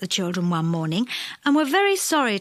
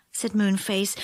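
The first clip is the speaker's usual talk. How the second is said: ordinary delivery, nothing marked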